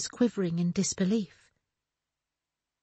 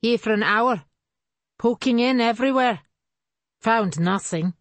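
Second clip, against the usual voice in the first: uncle's raised voice, loud and rough; calling over loud; 4 semitones higher, 6 dB louder than usual. A narrator's reading. Uncle calls across the yard, louder. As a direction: call it across the group, loud.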